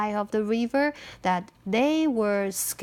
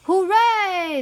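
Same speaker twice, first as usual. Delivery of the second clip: high, loud cheer; joyful cry; 9 semitones higher, 5 dB louder than usual. A narrator's reading. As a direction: cheer out in celebration. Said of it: "they cried".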